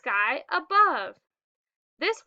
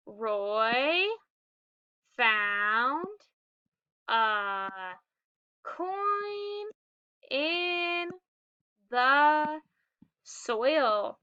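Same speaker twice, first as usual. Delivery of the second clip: each word read slowly, one at a time, with pauses between; much slower than usual. Shown as a demonstration. Teacher leading the class in slow word-by-word reading. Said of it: "Let's read it together".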